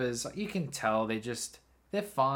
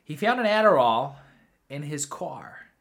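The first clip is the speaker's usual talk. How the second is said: put-on American accent; 7 dB louder than usual. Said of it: "I just like to say that in an American accent".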